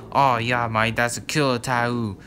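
loud mock Australian accent. Vlogger mimicking an Australian on a tattoo show, mock Australian accent. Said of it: "that's how Australians, like, say tattoo".

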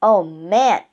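plain reading voice

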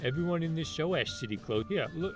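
ordinary talk, nothing marked

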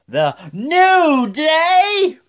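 high, loud, long-drawn introduction; announcer yell. Retold or imitated. Introducing the hosts, hammed-up announcer call. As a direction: stretch the name out like a ring announcer. Mimics hype introduction of team.

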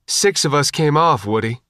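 usual speaking voice

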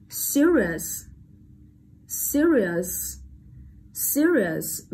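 serious with SE drawn out and stressed; careful model of serious, falling after the first syllable. Shown as a demonstration. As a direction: lean hard on the SE of serious. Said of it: "đầu tiên đúng không".

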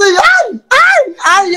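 loud, high-pitched cries of pleasure; demonstrated scream. Shown as a demonstration. Showing the interviewer how they scream, high loud yells. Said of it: "Yo grito así".

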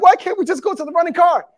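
high, hurried imitation of a crying girl; mock tearful plea. Preacher repeats the crying girl's plea for the running car, high mock wail. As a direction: whine it urgently like a panicked movie victim. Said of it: "she's crying".

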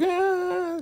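long, very high falsetto squeal; hammed-up high "aah"; mock pained scream. Imitates Lacey being hit.

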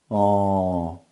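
ordinary talk, nothing marked

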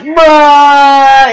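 one word held out loudly, level pitch; long loud bruh. Retold or imitated. Kid replying to the pizza guy, long loud bruh. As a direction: drag it out as a loud mock comeback. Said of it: "and I'm like".

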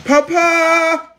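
high, drawn-out call; high-pitched holler for someone. Real, not acted. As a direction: call out for Papa, stretching it long. Calling out to someone.

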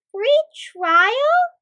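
high, loud, rising disbelief; shocked question. A narrator's reading. The two heroes hear the catch, shocked. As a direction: echo it back in shock. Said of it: "Mikael and Malaika were shocked".

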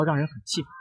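plain reading voice

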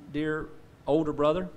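ordinary talk

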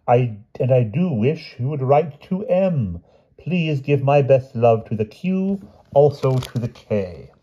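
high, yearning royal love poem; passionate recitation. A narrator's reading. King reads his third poem to Piggy, passionately. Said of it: "It was a passionate one".

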